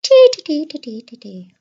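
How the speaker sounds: "T" repeated fast, starting very high and loud, then sinking and fading; Irish T trick. Shown as a demonstration. Teacher showing her class how "T" five times sounds Irish. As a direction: rattle off "T, T, T" from a high burst down to nothing. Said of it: "Try that".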